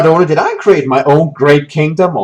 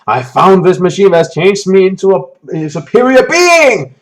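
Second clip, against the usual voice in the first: grandiose boast; 5 semitones higher, 4 dB louder than usual. Retold or imitated. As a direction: puff up like a self-made god. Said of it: "and says".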